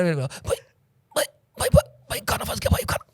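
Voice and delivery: frantic mock choking, begging brother for food; mock choking plea. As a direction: gasp out bhai like food is stuck. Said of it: "उसमें वो करता है".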